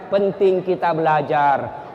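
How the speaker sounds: ordinary talk, nothing marked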